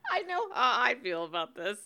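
usual speaking voice

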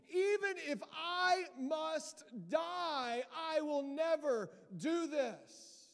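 loud, high-pitched devout vow; full-voiced declaration, slowing hard at the end; Peter. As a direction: cry out an impassioned pledge of loyalty. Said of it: "He pipes up and he's like".

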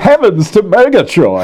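Snagglepuss voice. Pretend. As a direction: ham it up like Snagglepuss. Snagglepuss impression.

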